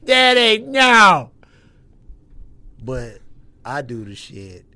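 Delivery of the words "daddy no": put-on high, loud child crying out; son's voice. Little son yelling at his daddy to stop, high loud child. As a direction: cry out like a scared little boy. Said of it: "Then my son".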